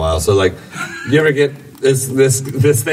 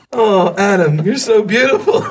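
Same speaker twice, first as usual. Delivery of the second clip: very high mock-student voice; high-pitched, swooning student impression; imitating gushing students; 12 semitones higher than usual. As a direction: gush it in falsetto. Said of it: "Sometimes my students".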